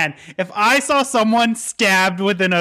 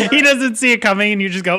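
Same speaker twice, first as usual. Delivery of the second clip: normal talking voice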